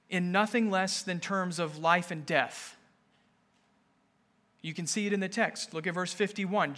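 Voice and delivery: normal talking voice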